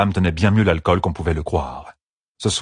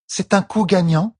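high, slow, curious question; curious young woman; 12 semitones higher than usual. Acted. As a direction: ask it wide-eyed, slowly. Tuon asks, voiced higher.

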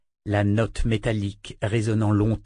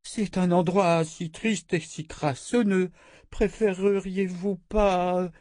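fawning queen; 7 semitones higher, slower than usual. Acted. Queen pleads to avoid dungeons.

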